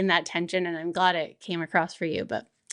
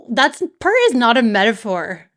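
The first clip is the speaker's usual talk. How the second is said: normal talking voice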